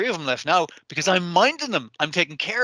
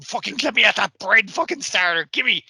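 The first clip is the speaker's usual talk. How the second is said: hammed-up yell, higher and a bit louder, grabbing for the bread; half-playful cage-rattling outburst; mock angry demand; 5 semitones higher, 4 dB louder than usual. Senshi rattling the cage walls.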